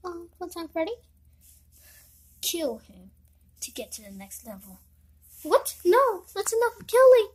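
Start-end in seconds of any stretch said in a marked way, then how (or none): 2.4-4.8 lower, fading cold command; villain order. Acted. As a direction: coldly order the player to kill.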